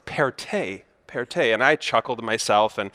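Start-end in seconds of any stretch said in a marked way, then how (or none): 0.0-1.5 "per te" said as pair-TAY, with a T; corrected Italian pronunciation. Retold or imitated. Local correcting a visitor's Italian phrase, "per te" said pair-TAY. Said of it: "saying the correct phrase".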